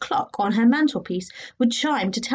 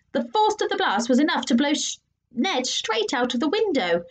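usual speaking voice

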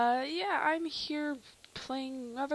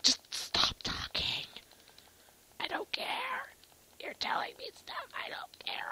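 hushed annoyed whisper; 3 semitones lower than usual. Real, not acted. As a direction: brush them off in a whisper. Whispered dismissal of dialogue.

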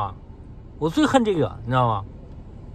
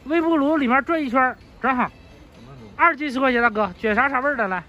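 high, projected seller's voice; street vendor's sales patter; 7 semitones higher than usual. Real, not acted. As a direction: pitch it to the customers.

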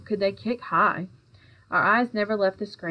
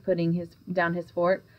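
ordinary delivery

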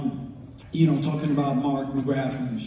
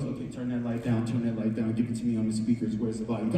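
businesslike producer; 7 semitones lower, 6 dB quieter, faster than usual. Retold or imitated. Imitates producer giving orders at board.

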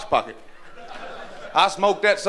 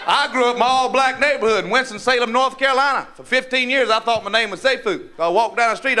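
usual speaking voice

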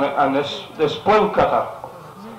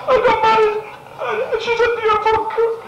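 mock sobbing, pitched very high; crying. Old man explaining his marriage to a stranger, tearful wail. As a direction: blubber theatrically between the words. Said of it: "He's sitting there crying".

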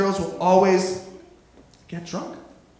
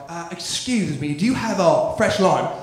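put-on lower-pitched English customer accent; mock English bar patron's accent; 4 semitones lower than usual. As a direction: ask politely in an English accent. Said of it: "People from England".

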